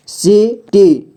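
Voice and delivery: City slowly as see, pause, tee; syllable by syllable. Shown as a demonstration. Teacher showing beginners how to read city, syllable by syllable. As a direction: break city into two long syllables. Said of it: "जोड़ जोड़ करके ही पढ़ना होगा".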